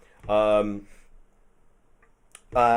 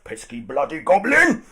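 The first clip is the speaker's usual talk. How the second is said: loud, higher grumbling insult; annoyed outburst; 7 semitones higher, 8 dB louder than usual. Acted. Brute swatting the goblin away, loud and annoyed. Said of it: "He's like".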